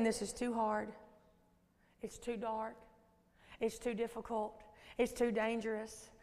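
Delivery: low, soft murmur of doubt; lightly voiced, subdued discouragement; discouraging enemy voice. Preacher voicing the enemy's doubts she hears daily, quietly discouraging. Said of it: "I hear the voice of the enemy".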